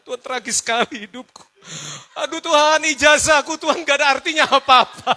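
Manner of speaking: loud, high mock wail to God; hammed-up tearful lament; mock crying. Rider lamenting to God about a useless diploma, mock wailing. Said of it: "saya nangis".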